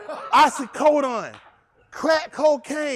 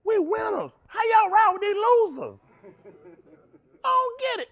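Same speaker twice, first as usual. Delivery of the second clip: taunting shout; 10 semitones higher than usual. Real, not acted. Fan taunting rival fans, mock-outraged gloating. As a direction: rub it in their faces.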